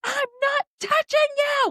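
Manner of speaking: high mock shouting at her daughter; retold yelling. Mom yelling back at her toddler in bed, high mock shout. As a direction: shout it shrill like a squabbling kid.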